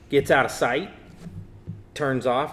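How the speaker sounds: ordinary talk, nothing marked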